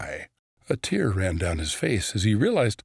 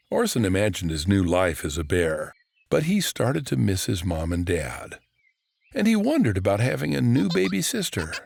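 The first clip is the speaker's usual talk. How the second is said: ordinary delivery, nothing marked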